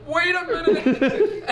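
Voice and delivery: a very high, slow, laughing drawn-out call; mock slow call. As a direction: stretch it out high, half laughing. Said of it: "And then she goes".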